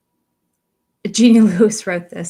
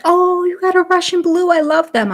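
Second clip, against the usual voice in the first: high, louder gushing vet tech; imitated delight; 12 semitones higher, 6 dB louder than usual. Vet tech to cat owner about the breed, imitated gushing. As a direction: gush like an excited animal lover, up high.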